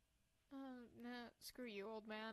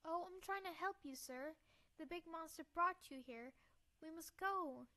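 lightly voiced young heroine; urgent girl; 6 semitones higher, 5 dB louder than usual. Girl urges the tied-up doctor to leave, earnest. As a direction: plead earnestly in a raised girl voice.

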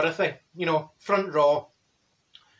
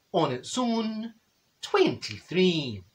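very slow, stretched-out number call; solemn, drawn-out bingo calling; bingo caller; 3 dB quieter, much slower than usual. Acted. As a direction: draw out each word for suspense.

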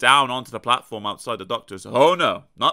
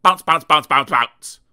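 loud bounce calls; 11 dB louder than usual. A narrator's reading. Sound effect of bouncing.

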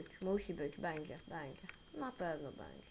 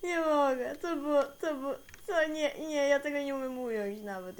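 high, loud, overwhelmed stammering; rattled, voice pushed up; shaken by fright; 6 semitones higher, 4 dB louder than usual. Real, not acted. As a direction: struggle for words after a real scare. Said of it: "Jezu, jak się wystraszyłem".

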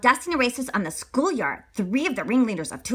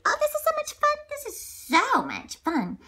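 put-on high delighted kids. Kids having fun.

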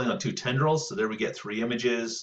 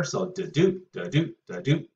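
da-DOOT sung out as weak-strong, weak-strong; iambic beat; 3 semitones higher than usual. Shown as a demonstration. Teacher showing students the iambic rhythm, stressed second beat. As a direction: tap out a rising iambic beat on each da-doot. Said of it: "your Shakespeare is all iambic pentameter".

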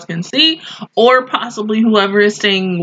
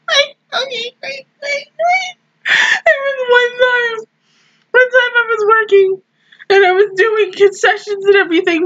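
very high, squeaky, laugh-choked restart; recovering from laughter; 12 semitones higher than usual. Real, not acted. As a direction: struggle to resume the story through giggles.

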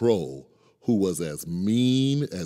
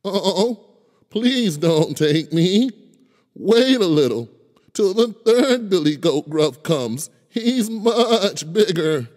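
pleading goat; 12 semitones higher, 7 dB louder than usual. Acted. Middle goat pleads with troll.

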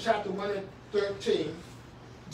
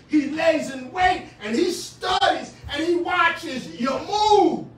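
higher and louder, ringing sermon cadence; preaching at full force; 6 semitones higher, 7 dB louder than usual. Acted. Pastor warning congregation of the devil, full-force preaching. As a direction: whoop it up for the pews.

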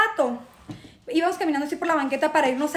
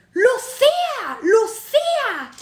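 high, drawn-out holler; mock shout; 7 semitones higher, 4 dB louder, much slower than usual. Man grabbing woman on dance floor, high drawn-out shout. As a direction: stretch the name out in a high yell. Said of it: "Te estoy gritando desde afuera".

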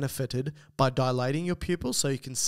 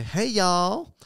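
higher, louder American "y'all"; American accent; 6 semitones higher, 5 dB louder than usual. Acted. Host reading Mary's listener email aloud, American accent. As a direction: put on an American twang for the greeting. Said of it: "this is an American accent".

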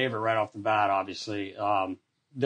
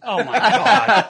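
laughing disbelief; 9 semitones higher, 7 dB louder, much slower than usual. Retold or imitated. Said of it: "I'm like".

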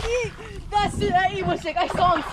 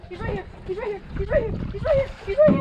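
each repeat more urgent; rising urgency; 6 semitones higher, faster than usual. Real, not acted. Angler pointing out the fish, urgency building to a call.

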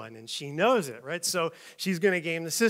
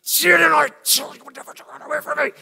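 strained, harsh whisper-yell; yelling with her face; 9 semitones higher, 8 dB louder than usual. Acted. Angry mom to found child, suppressed yelling. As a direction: yell under your breath, high and tight. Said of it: "she's yelling at you with her face".

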